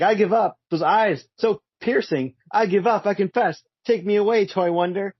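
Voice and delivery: high-pitched, hammed-up panicked surrender; mock screaming. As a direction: plead in mock terror without really shouting. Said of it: "he starts screaming".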